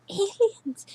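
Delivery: high squealing shout; excited shout. Real, not acted. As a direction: blurt it out, thrilled. Shouted echo of aliens.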